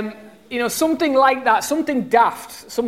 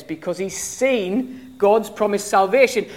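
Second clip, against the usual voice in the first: usual speaking voice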